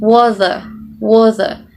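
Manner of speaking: WATER said "wah-der", T turned to D; American soft T. Shown as a demonstration. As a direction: tap the T in "water" like a D. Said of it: "It becomes a D".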